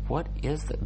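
squinting at a mystery sound; baffled. Real, not acted. Vlogger still puzzling over the hotel noise, baffled.

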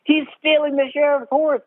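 high-pitched accusing cry; mock alarmed crowd. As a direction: imitate villagers raising the alarm. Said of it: "everyone goes".

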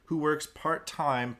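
ordinary delivery

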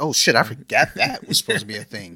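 loud retold sudden realisation; surprised outburst. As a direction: blurt it out as if just remembering. Retells own surprised realisation.

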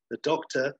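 usual speaking voice

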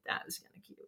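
soft whispered aside. Real, not acted. Host admitting to herself the joke was cute, whispering. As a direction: whisper it like a private thought.

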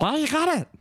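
squeaky high goblin. Acted. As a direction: pitch it way up, goblin-like. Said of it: "he's like".

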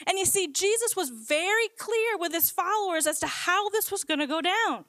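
ordinary talk, nothing marked

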